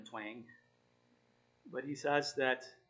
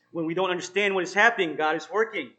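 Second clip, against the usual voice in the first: louder, urging preacher's voice; emphatic preaching; 4 semitones higher, 12 dB louder than usual. Acted. Pastor urging the struggling listeners, louder and emphatic. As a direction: press the promise on them.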